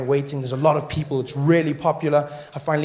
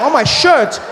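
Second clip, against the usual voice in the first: very high, loud shouted disbelief; a high, loud shout of disgust and shock; outraged disbelief; 13 semitones higher, 8 dB louder than usual. Retold or imitated. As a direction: yell in horror at the idea. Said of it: "I'm like".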